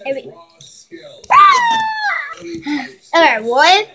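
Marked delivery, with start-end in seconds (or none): 1.3-1.9 sudden high shriek; high scream. Real, not acted. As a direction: let out a sharp high scream. Sudden scream during challenge.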